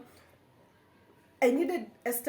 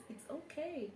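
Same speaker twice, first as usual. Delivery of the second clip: much softer, lighter version; trying a softer take; gentler it's okay; 4 semitones higher, 13 dB quieter than usual. Shown as a demonstration. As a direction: try to sound less dismissive. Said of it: "can I say it like this".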